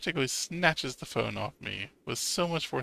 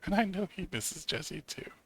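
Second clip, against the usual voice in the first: normal talking voice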